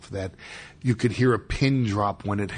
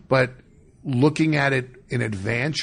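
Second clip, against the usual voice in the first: normal talking voice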